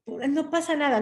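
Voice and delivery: high-pitched imitated brush-off; dismissive. Voicing the dismissive other person.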